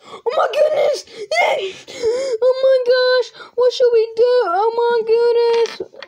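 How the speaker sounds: high, loud, squealing excitement; excited shrieks. Pretend. Child thrilled by a toy discovery, high excited shriek. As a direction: shriek it out like a kid in shock. Toy finds something shocking.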